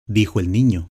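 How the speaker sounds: usual speaking voice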